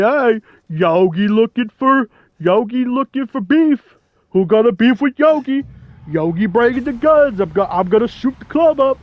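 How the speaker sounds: hammed-up gangster Yogi voice; Yogi Bear. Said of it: "I'll stop with the Yogi voice".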